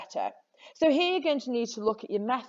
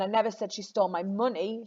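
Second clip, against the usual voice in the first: "money" stressed at the very end. Shown as a demonstration. Teacher shows students how meaning shifts, stressing "money". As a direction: land the weight on "money". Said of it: "She didn't steal money".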